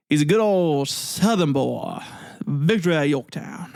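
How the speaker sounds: hammed-up Southern good old boy, drawn out slow; southern accent. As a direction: lean into a slow Southern drawl. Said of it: "southern, like, accent there".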